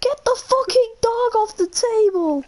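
high, calling mother's cry; mum yelling. Retold or imitated. Imitating the mum scolding Mario over the dog, a high yell. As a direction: shout across the house at her son. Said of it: "His mum goes".

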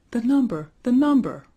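high, loud, urgent exclamation; impatient storybook outcry; urgent cry. A narrator's reading. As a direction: cry it out twice, demanding an answer. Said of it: "cried Sabrinetta".

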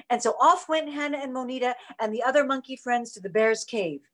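plain reading voice

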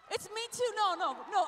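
normal talking voice

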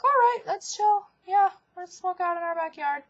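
high, breezy going-along voice; mock eager agreement. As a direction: sing out a carefree yes. Mock-agreeing with friends' plan.

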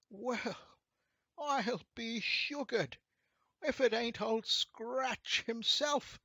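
high, loud, drawn-out rascal voice; amazed shabby rascal. A narrator's reading. Shabby rascal stunned to meet Old Scratch himself, amazed. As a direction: gawp aloud in slow amazement.

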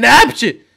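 high, loud sneeze. Acted. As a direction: burst out in a squeaky sneeze. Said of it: "üçünüz de hapşırıyorsunuz". (hapşırma)

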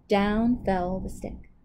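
plain reading voice

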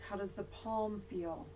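soft, unhurried yoga nidra voice with long pauses; guided meditation. Real, not acted. Yoga teacher leading resting students through body awareness, guided meditation voice. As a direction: guide them gently into body awareness, leaving long silences.

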